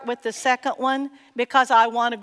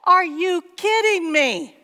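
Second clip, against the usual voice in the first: higher and louder, playing a fed-up worrier; hammed-up disbelief aimed at Paul; mock exasperated protest; 8 semitones higher, 6 dB louder than usual. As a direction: pretend complaint to Paul about money, mock exasperated. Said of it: "And I want to say".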